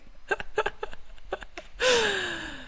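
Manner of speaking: long, high peal of real laughter; helpless laugh. Real, not acted. Cracking up at the diner story, helpless laugh. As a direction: laugh until it tails away.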